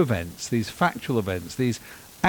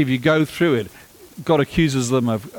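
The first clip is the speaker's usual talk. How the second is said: ordinary talk, nothing marked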